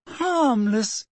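appalled friend repeating Hagrid's word; shrill. A narrator's reading. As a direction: fling the word back in disbelief. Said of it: "said Hermione shrilly".

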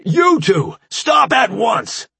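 high, loud, slow order; alien guard command. A narrator's reading. Sarkin guard ordering the fighters apart, loud. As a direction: bark it through a mask. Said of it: "through his breathing mask".

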